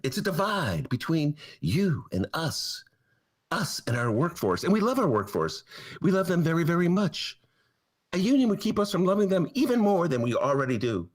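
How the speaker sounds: a hint of a smarmy boss; mock corporate sincerity. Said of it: "the Amazon theory".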